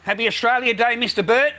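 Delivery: loud, high greeting across the street; calling out. Real, not acted. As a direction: call out cheerfully to the neighbour. Greeting a neighbour from afar.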